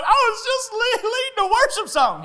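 high, mock-tearful wail; crying protest. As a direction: imitate a wife sobbing her excuse. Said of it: "I can hear her crying".

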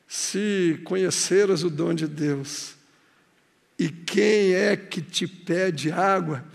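low, soft, slow tender voice; tender Jesus. Acted. Jesus gently speaking to the woman at the well, tender and soft.

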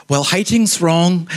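slow, mock-pious correction; hammed-up moralising, drawn out.

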